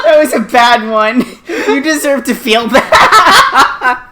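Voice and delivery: loud, high, laughing reproach; laughing scolding. Real, not acted. Wife to husband over a bad pun, laughing scolding. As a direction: scold him while cracking up.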